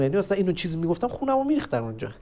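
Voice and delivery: ordinary talk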